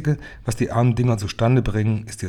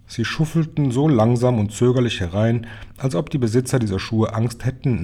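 usual speaking voice

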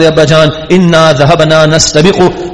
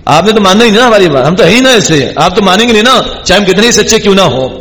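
higher, fast mock whining; guilty brothers' tone; 3 semitones higher than usual. Brothers telling their father he won't believe them, mock whining. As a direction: whine defensively like caught-out sons. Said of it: "ابھی tone ہی بتا رہی ہے".

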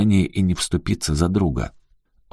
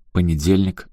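ordinary delivery

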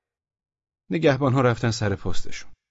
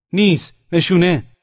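high, loud demand; sentry's shout; 7 semitones higher, 9 dB louder, slower than usual. A narrator's reading. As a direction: shout the challenge in short bursts. (داد زدن نگهبان)

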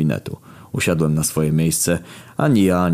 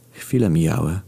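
ordinary talk

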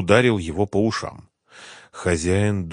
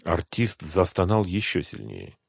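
ordinary delivery, nothing marked

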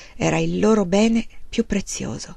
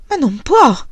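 higher and louder, shocked protest; cry out; 5 semitones higher, 6 dB louder, slower than usual. Acted. Wife cries out in protest to her husband, higher and louder. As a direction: cry it out in disbelief. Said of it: "Caroline gridò".

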